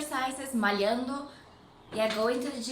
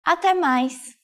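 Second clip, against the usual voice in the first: Até mais repeated higher and brighter; 5 semitones higher, 10 dB louder than usual. Shown as a demonstration. Teacher repeating the phrase higher for learners. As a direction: lift the pitch on Até mais. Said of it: "You can also say".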